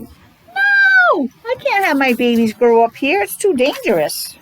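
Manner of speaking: high-pitched mother duck, anxious and protective; a storybook mama duck refusing, lightly voiced; worried mother duck. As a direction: squeak out the no, then worry for the babies. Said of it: "she's like".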